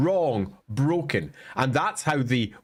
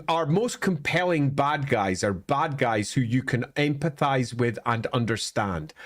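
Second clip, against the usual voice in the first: ordinary talk, nothing marked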